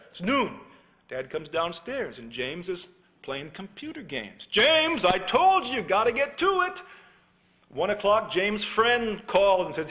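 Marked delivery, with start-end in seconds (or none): 4.4-6.8 high, loud scolding; exasperated dad. Retold or imitated. Dad catching son at games, loud and high. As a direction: bark at the son from the doorway.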